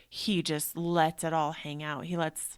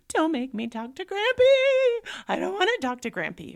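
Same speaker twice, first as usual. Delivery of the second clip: put-on pleading child; 11 semitones higher, 9 dB louder than usual. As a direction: whine like a scared little boy. Child pleading, voiced by mom.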